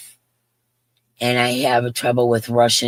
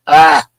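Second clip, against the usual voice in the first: surprised cry; 5 semitones higher, 11 dB louder than usual. Real, not acted. Delighted at caption tip.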